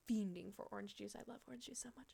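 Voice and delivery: hushed whisper, almost no voice. Real, not acted. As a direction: confess it in a whisper. Whispered confession about juice.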